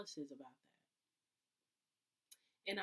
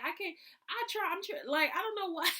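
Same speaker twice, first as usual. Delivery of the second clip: higher, louder exasperated whining; frustrated whine; 11 semitones higher, 11 dB louder than usual. Pretend. Venting about the car, higher and louder whining. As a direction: whine like nothing works out. Acting her own frustrated complaint.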